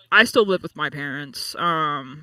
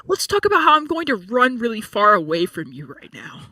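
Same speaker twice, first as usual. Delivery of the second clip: higher, bright mock-chirpy quote; mock cheerful quote; 6 semitones higher, 4 dB louder than usual. Topping the friend's joke in a mock chirpy higher voice. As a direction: play along with the joke, voice lifted. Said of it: "It's like".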